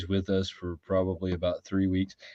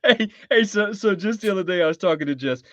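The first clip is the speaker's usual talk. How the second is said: laughing; 12 semitones higher, 3 dB louder than usual. Real, not acted. Cracking up over iguanas.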